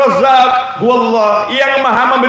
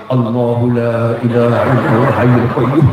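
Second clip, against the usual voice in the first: low, mumbled prayer under the breath; mock mumbling prayer; 10 semitones lower than usual. Wife mumbling prayers at her husband, low mock mutter. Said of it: "Jangan kamu komat kamit".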